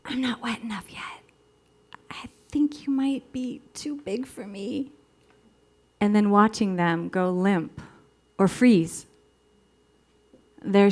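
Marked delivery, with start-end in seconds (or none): 0.0-4.9 high, hushed, hesitant whisper; timid whisper. Acted. Young woman confessing to her lover, timid whisper. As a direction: whisper it as if it is excruciating to say. Acting out shy words to lover.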